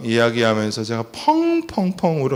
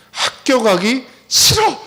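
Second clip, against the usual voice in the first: put-on higher, slow whining kid; whiny child; 11 semitones higher, 4 dB louder, slower than usual. Father mimicking his son's complaint, a slow whine.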